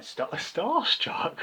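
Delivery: high, slow, incredulous laughing echo; laughing in disbelief. Retold or imitated. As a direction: laugh the word back at him, mocking. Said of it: "I actually laughed".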